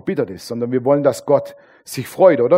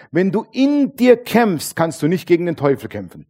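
ordinary talk, nothing marked

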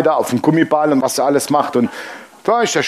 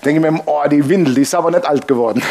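ordinary talk, nothing marked

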